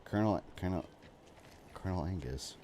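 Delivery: very soft, testing the words to oneself; trying out pronunciation. Shown as a demonstration. Streamer sounding out a pun for himself, very quiet. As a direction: say it low and quiet, listening for the pun. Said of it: "I'm trying to pro-, I don't hear it".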